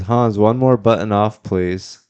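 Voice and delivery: loud call out. Retold or imitated. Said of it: "someone says".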